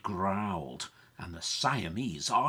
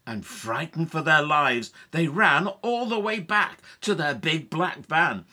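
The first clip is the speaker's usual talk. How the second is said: louder, higher, excited telling; raised, urgent narrator voice; dramatic story narration; 6 semitones higher, 9 dB louder than usual. A narrator's reading. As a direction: narrate a frantic escape. Narrates the escape.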